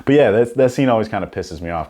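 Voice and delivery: ordinary talk, nothing marked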